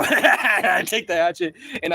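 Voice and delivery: loud, slow laugh breaking into the words; laughing while telling. Real, not acted. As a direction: laugh through the line, thrilled. Laughing while retelling the fight.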